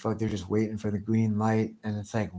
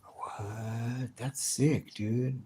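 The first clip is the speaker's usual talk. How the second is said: ordinary talk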